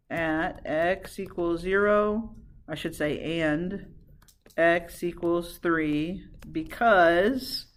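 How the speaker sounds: low, slow, drawn-out phrases; slow dictation. Real, not acted. Teacher writes out the answer for class, slow dictation. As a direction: pace it for someone copying down.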